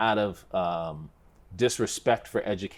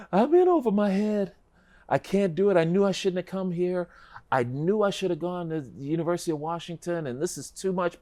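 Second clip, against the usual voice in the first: higher, pleading, close to tears; a panicked freshman begging his dad, higher and pleading; panicked crying plea; 9 semitones higher than usual. Retold or imitated. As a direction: retell your tearful collect call home. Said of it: "And I was like".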